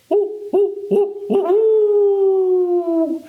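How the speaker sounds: high, short owl hoots with a long last one; high-pitched, hollow hooting. Pretend. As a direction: hoot like an owl. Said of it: "They hoot".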